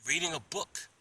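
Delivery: high-pitched, matter-of-fact retort; said just like that. Retold or imitated. Man reading answers the officer, pointed reply. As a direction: answer high and short, after a long pause. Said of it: "And I said it just like that".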